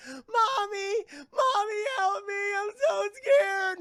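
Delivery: put-on high, loud wailing child voice; scared little girl. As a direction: cry out for mommy like a frightened kid. Little girl calls from the portal.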